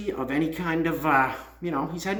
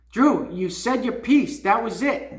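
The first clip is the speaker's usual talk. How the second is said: impassioned appeal; 5 semitones higher, 4 dB louder, slower than usual. Real, not acted. Impassioned direct appeal to Drew.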